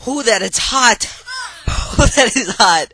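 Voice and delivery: high, loud yelp; pained shout. Real, not acted. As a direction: shout from the heat. Touching the hot phone.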